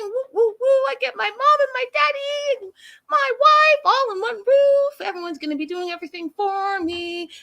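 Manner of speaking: put-on a spoiled man-child cheering; giddy childish Sumit. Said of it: "Sumit's like".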